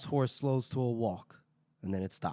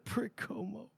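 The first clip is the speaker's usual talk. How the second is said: mock muttered curse; 6 semitones higher than usual. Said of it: "Sweet fucking pissed".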